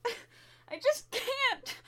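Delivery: very slow and defeated; slow, hopeless trailing line; despairing, very slow. Acted. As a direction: draw it out, slowly, in despair. Breaking down alone.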